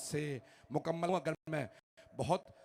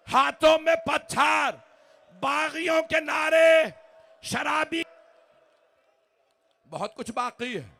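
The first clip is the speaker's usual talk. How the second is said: full-force mourning recitation; 11 semitones higher, 13 dB louder than usual. Acted. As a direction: cry the masaib out to the whole majlis. Majlis lament at full force. (पूरे ज़ोर से मसाइब पढ़ना, ऊँची चीखती आवाज़)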